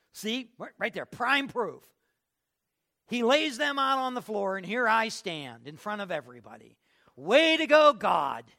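high, loud mock outburst at being left out; indignant complaint. Preacher voices a passed-over worshipper griping at God, indignant. Acts out someone left standing, resentful.

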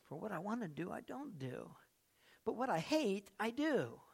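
soft, quiet repeat of the verse; hushed scripture. Real, not acted. Pastor repeating Paul's words quietly to the church. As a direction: say it almost to yourself, softly.

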